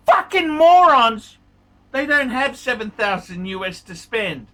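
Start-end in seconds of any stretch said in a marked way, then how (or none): 0.1-1.4 angry emphasis. Real, not acted. Ranting about morons and money.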